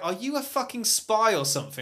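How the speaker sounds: acted baffled English local; mock suspicion. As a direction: challenge them as if suspicious. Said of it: "everyone would be like".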